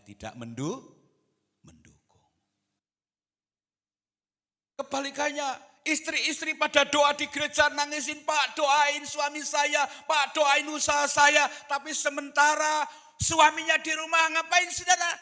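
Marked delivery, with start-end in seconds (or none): 4.7-15.1 very high, loud pulpit cry; full-force preaching. Real, not acted. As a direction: shout it out to the congregation, voice held high. (berkhotbah lantang dengan nada tinggi)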